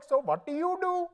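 put-on high-pitched Indian accent. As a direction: attempt British but land in Indian. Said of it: "I said it Indian Lee".

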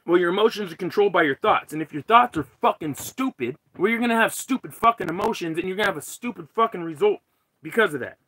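loud, emphatic rant; heated. Real, not acted. Streamer lecturing viewers on stupid thoughts and emotions, heated. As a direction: drive the point home with real frustration. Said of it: "I'm fucking heated today".

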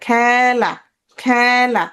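খেলা, খেলা, each with a stretched "æ"; careful, drawn-out "khæ-la" for খেলা; open vowel. Shown as a demonstration. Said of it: "এই যে মাত্রাযুক্ত একার".

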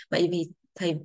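normal talking voice

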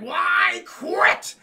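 high squawking shout; shouted quit. Retold or imitated. Daffy yelling at Kate as he quits, loud shout. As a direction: throw the words out in a tantrum.

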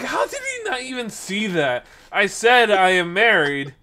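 high-pitched protest, building to loud; exasperated disbelief. Retold or imitated. Exasperated at matchmaking dad.

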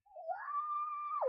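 very high, held squeal; excited high squeal. Real, not acted. Vlogger seeing herself lit up, high squealed wow. Mock amazement when light comes on.

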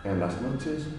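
animated declamation over music; poem recital. Acted. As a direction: recite it out to the room, rising and settling by phrase.

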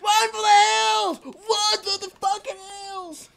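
high, loud, drawn-out yell; mock panicked shout. Player fleeing in game, mock panicked shout. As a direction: shout the alarm as you flee.

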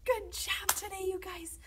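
high, upbeat cheer; excited praise. Real, not acted. Leader congratulating children after singing, high excited praise. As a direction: praise them in a high happy voice.

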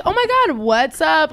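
high, loud gushing hello; excited greeting. Retold or imitated. Drunk woman greeting a man unexpectedly, excited squeal.

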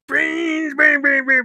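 high shouted "bing, bing, bing"; zombie noises. Retold or imitated. Reviewer mimics the cadaver running around, yelping. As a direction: yelp it like a frantic corpse. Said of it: "running around like".

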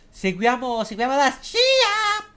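mock squeaky voice; high voice. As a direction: pitch it way up and stretch it. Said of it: "se faccio la voce acuta".

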